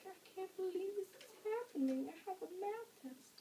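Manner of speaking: small, high, soft tearful whine; hushed mock sob in a high voice; imitated crying. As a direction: whimper it quietly, acting out the tears. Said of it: "And then I start crying".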